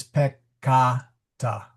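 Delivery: "peccata" slowly as pek-KAH-ta, long middle a; stress on second syllable. Shown as a demonstration. Teacher showing where the accent falls, stressed long middle syllable. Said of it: "the accent, this sylla-, this vowel is long".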